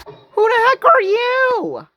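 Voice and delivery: high, drawn-out cry of surprise; startled outburst. Real, not acted. Film commenter spots an unknown character, startled outburst. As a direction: blurt it high and long at a stranger.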